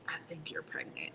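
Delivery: hushed secret whisper. Retold or imitated. Telling the captain privately, in a whisper.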